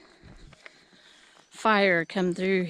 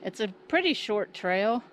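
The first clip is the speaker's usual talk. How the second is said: ordinary delivery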